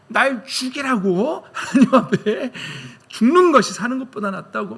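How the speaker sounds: hammed-up sulky defiance toward God; defiant demand. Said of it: "예, 덤빕니다".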